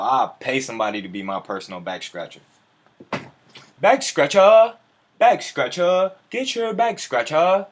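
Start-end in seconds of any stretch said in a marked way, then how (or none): 3.8-7.7 high, loud, stretched hawker cry; street vendor call. Pretend. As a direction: shout it like a market seller selling back scratchers. Hawking back scratchers like vendor.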